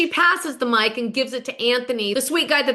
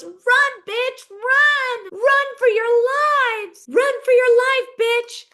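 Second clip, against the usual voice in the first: high, loud jeering yell after someone leaving; mock taunting yell; 12 semitones higher, 4 dB louder, slower than usual. [name] jeering as Tamara walks out, high mocking yell. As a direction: belt it out like a taunting bully.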